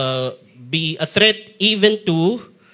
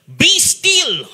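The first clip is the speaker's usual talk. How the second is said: high, loud rebuke; firm command; 11 semitones higher, 4 dB louder, much slower than usual. Acted. Jesus commands the wind, firm rebuke. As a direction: order the storm to stop.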